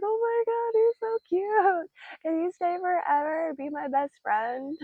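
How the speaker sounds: high, slow cooing at a cute bat; gushing baby talk. Retold or imitated. Speaker gushes to the adorable bat, high cooing. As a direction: coo like you've found a pet.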